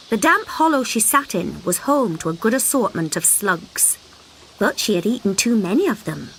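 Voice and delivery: usual speaking voice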